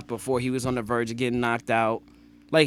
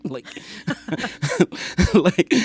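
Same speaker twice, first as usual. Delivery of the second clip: ordinary talk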